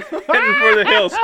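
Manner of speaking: laughing through the words. Real, not acted. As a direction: laugh while telling it.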